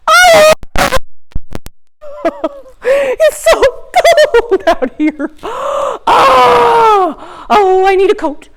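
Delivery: high, whimpering little sprout freezing; shivering sprout. Pretend. Teacher voicing the freezing sprout for kids, high and whiny. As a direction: wail as a cold little plant.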